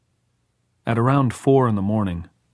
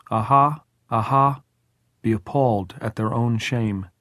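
solemn psalm recited over a grave; measured prayer; 3 semitones higher than usual. A narrator's reading. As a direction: press on phrase by phrase, raised and deliberate. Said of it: "Tom pressed on".

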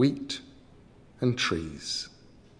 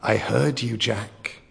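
airy troll voice like wind; whispery wind voice; slower than usual. Acted. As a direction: breathe it like the wind. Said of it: "he whispered, in a voice like the wind".

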